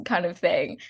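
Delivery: ordinary talk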